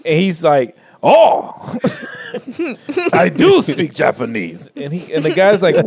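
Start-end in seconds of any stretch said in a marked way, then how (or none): 1.0-4.7 high, laughing mock Japanese-accented English. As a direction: imitate Trevor Noah slipping into a Japanese accent. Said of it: "the Japanese kind of like tone".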